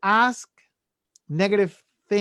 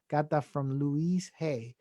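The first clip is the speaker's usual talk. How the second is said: usual speaking voice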